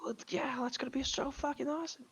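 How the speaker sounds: excited hushed whisper. Real, not acted. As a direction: whisper it, barely voiced. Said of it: "'cause I have to whisper".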